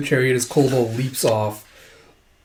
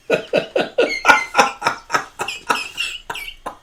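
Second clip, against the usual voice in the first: long high laugh in bursts; real laughing fit. Real, not acted. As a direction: burst out laughing, unvoiced wheeze between. Storyteller laughs at the scene.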